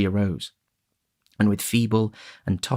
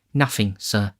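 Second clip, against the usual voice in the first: ordinary delivery, nothing marked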